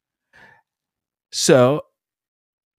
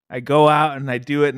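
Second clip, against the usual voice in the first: ordinary delivery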